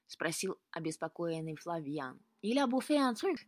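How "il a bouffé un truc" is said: sudden raised outburst in French; exclaiming. A narrator's reading. Grenka answers worried Flavian in French, exclaiming. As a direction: exclaim it higher and louder. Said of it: "воскликнула Гренка".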